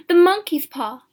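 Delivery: high, very loud excited outcry; sudden cry. A narrator's reading. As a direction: cry out as if it just struck you. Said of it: "she cried".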